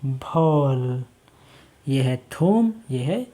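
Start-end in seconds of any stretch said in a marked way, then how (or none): 0.0-1.0 झील stretched very slowly; drawn-out blending. Shown as a demonstration. Teacher slowly sounding out the blended word. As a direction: draw झील out for the children. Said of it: "तो क्या हो गया?".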